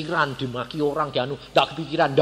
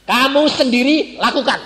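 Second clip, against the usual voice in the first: firm order; 10 semitones higher, 7 dB louder than usual. Retold or imitated. Pastor retorts to visitors.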